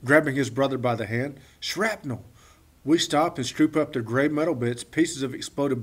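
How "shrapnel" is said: put-on higher boyish exclamation of Shrapnel; excited boy's voice. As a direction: Derek showing off his find, Shrapnel. said higher. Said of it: "says Derek".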